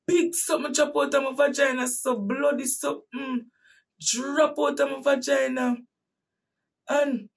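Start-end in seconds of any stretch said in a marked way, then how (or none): 0.1-5.8 alarmed retelling. Retold or imitated. Retelling the horror, raised voice.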